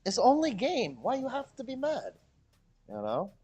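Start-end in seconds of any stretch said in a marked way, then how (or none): 0.0-2.1 high-pitched, teasing mock plea; mocking meme voice. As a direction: tease in a high put-on voice. Mocking angry people with meme lines.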